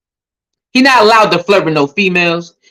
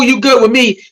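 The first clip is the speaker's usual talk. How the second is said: usual speaking voice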